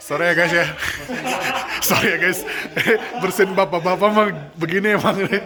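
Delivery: laughing apology; giggling through the words. Real, not acted. Laughing after a loud sneeze.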